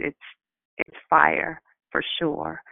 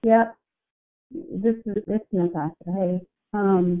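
muffled; 2 semitones higher than usual. Real, not acted. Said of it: "you sound muffled".

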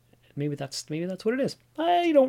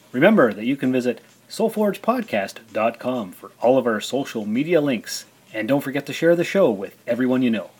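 bright, louder ad-read voice; podcast outro; 4 dB louder than usual. Acted. Thanking listeners at the close of the show, in an announcer read. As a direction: read it like a radio promo.

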